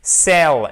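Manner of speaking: Cel hit hard and loud; stressed first syllable. Shown as a demonstration. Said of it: "La syllabe accentuée, c'est la première".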